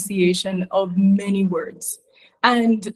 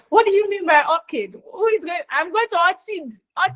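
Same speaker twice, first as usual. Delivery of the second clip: high, incredulous mocking voice; a teasing high put-on voice; mocking Lagos commenters; 8 semitones higher than usual. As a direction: mimic puzzled Lagos folk. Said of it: "they were like".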